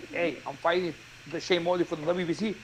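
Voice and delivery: ordinary talk, nothing marked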